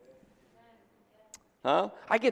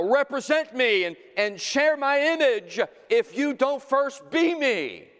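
full-force preaching; 12 semitones higher, 5 dB louder than usual. Acted. Preacher urging people to practice before representing Christ, full-force preaching. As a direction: ring it out over the congregation.

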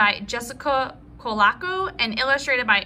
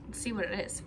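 hushed aside; 5 semitones lower, 12 dB quieter, faster than usual. Real, not acted. Hushed aside opening package.

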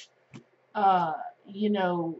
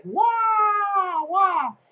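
excited wow; 10 semitones higher, 5 dB louder than usual. Pretend. Imagined reaction to big leaps.

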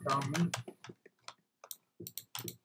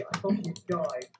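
usual speaking voice

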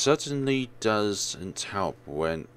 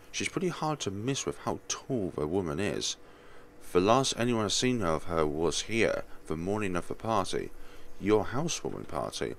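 ordinary talk, nothing marked

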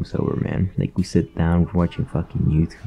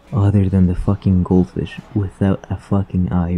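normal talking voice

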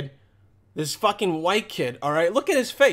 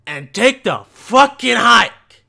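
angry dismissive shout; 4 semitones higher, 10 dB louder than usual. Real, not acted. Reactor dismissing the kid in the video, shouting. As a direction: yell them out of the room.

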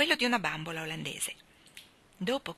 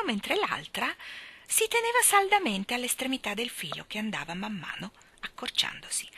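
ordinary delivery, nothing marked

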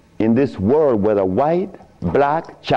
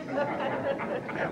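soft laugh; 9 semitones higher, 14 dB quieter than usual. Real, not acted. Laughing at his own joke.

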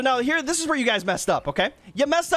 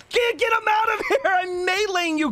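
frantic attack shout; 10 semitones higher than usual. Real, not acted. Player yelling at an opponent while attacking, frantic shout. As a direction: shout it mid-attack, pitch way up.

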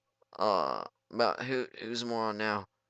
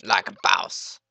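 loud, swaggering catchphrase; like a boss, belted out; hammed-up boast; 8 dB louder, slower than usual. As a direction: brag like a cocky meme. Repeats catchphrase louder.

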